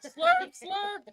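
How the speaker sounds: high, loud, hammed-up slurp; loud playful slurp. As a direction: belt out the slurps for fun.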